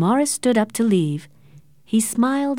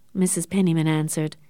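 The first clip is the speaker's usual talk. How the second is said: plain reading voice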